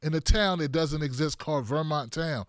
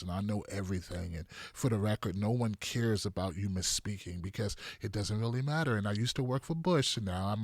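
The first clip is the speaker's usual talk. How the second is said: low, quiet, droning caricature of a self-important pundit; mock her talk in a hushed, low monotone; snide, self-important TV commentator impression, dropped low; 8 semitones lower, 6 dB quieter than usual. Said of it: "why does she talk like this".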